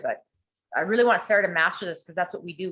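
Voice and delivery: normal talking voice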